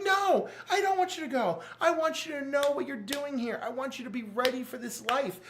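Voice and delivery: Wonder Woman lecturing. Retold or imitated. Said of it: "And she's like".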